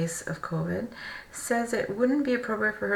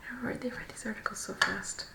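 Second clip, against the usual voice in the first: hushed quick comment; whispered aside; 8 dB quieter than usual. Real, not acted. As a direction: whisper it to the side. Whispered aside while reading.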